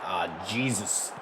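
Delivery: low, slow groan of dismay. Real, not acted. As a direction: drag it out in despair. Groans at bad play.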